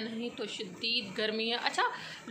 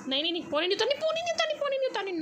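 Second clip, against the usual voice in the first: much higher, sing-song telling-off; high pleading scold; 12 semitones higher, much faster than usual. Real, not acted. Owner squeakily telling the cat not to spill water.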